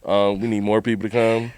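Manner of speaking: low, quiet mock cop radio call; a hint of nervous police calling backup; mocking panicked cops. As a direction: lightly play the scared officer calling backup. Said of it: "They looking at me".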